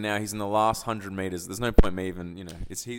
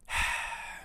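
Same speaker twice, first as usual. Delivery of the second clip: long voiceless exhale; heavy sigh before explaining; exasperated sigh; 7 dB quieter than usual. Real, not acted. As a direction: let out a weary, irked breath. Exasperated while explaining what irks him.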